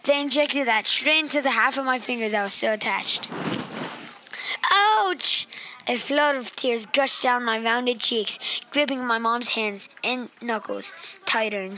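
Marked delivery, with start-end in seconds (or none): 4.7-5.5 sharp high yelp of pain; pained cry. Acted. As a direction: cry out at the needle. Shot injected into finger.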